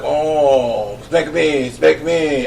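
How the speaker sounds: bad, soft French accent; a clumsy French accent, quiet; terrible French accent. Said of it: "I do a terrible French accent".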